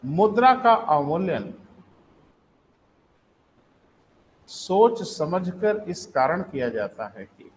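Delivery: slow, measured classroom dictation; slowly, phrase by phrase, for writing down. Real, not acted. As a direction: space the words out for note-takers. Teacher dictating for students to write.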